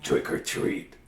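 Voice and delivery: whispered trick or treat; soft slow whisper; very quietly. Acted. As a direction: barely breathe the words. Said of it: "so he said very quietly".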